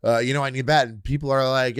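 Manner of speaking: normal talking voice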